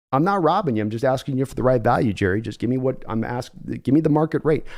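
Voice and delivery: lowkey John Schneider on the phone; low, weary team executive; calm Seattle GM. Acted. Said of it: "give John Schneider".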